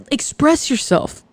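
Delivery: usual speaking voice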